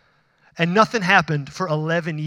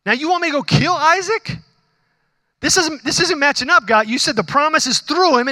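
higher, louder, incredulous complaint to God; protesting Abraham; 8 semitones higher, 3 dB louder than usual. Retold or imitated. Abraham questioning God's order to kill Isaac, incredulous. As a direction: push back at God as if it makes no sense.

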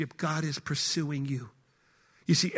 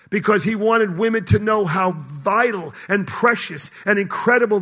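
fiery sermon climax; full-force preaching; 3 semitones higher, 7 dB louder than usual. Acted. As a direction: pound each all like a pulpit preacher.

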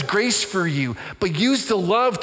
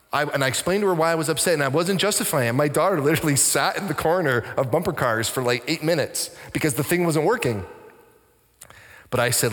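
normal talking voice

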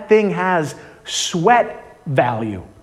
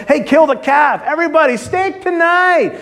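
a father yelling with delight; joyful yell; 9 semitones higher than usual. Retold or imitated. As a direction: call out the feast to the whole house. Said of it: "and he yells".

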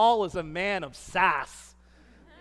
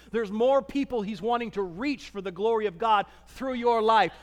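ordinary delivery

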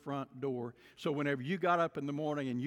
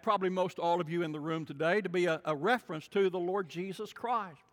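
usual speaking voice

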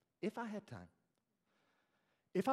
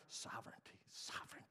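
hushed, insistent whisper; whispered retort; 2 semitones lower, 11 dB quieter than usual. Acted. Preacher answering free-will people, whispered retort. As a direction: whisper the word like a secret correction.

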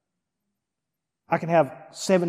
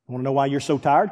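usual speaking voice

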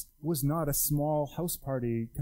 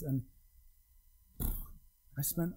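usual speaking voice

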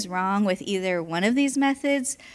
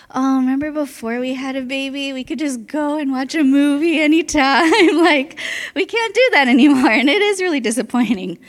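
laughing; 6 semitones higher, 7 dB louder than usual. Retold or imitated. Laughing retelling of husband's lament.

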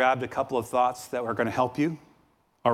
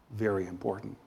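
normal talking voice

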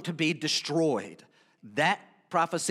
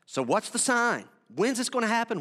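mildly insistent questioning, pitched up; eager questioning; 6 semitones higher than usual. Acted. Disciples asking Jesus about the sign, higher-pitched questioning. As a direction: press for an answer, voice lifted.

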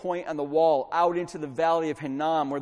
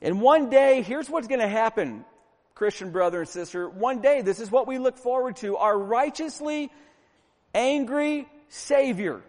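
higher, driving sermon delivery; forceful preaching; 6 semitones higher, 5 dB louder than usual. Real, not acted. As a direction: preach it hard, punching the key words.

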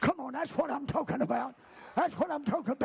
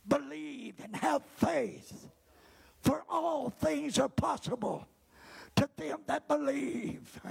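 usual speaking voice

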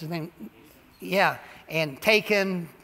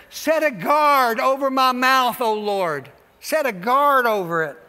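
fervent prayer; 3 semitones higher, 6 dB louder, slower than usual. Acted. Quoting David's prayer with force.

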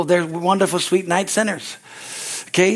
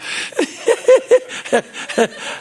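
high laugh. Real, not acted. Laughs at his own point.

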